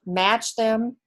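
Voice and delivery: ordinary delivery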